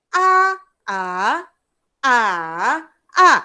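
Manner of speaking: A level, A rising, A dipping, A falling; four A's, each with its own tone; four tones in turn. Shown as a demonstration. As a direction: run A through all four Mandarin tones, loudly. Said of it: "empat-empat tones tu".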